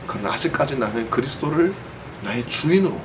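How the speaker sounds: ordinary delivery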